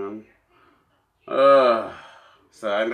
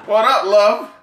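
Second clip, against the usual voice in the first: very high, loud, laughing mock British slang; mock British accent; 11 semitones higher, 8 dB louder, slower than usual. Joking about London women, a very high, loud laughing mock British accent. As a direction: ham up a bad London accent through laughter. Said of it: "mock British slang".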